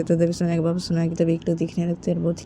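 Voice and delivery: normal talking voice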